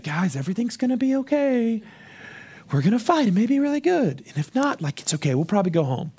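mock-gentle pep talk that trails downward; mock comforting. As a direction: meekly console the troops instead of rallying them. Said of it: "They were looking for comfort".

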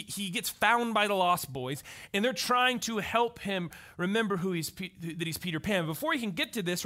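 usual speaking voice

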